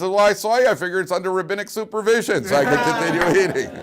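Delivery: cheeky punchline. Acted.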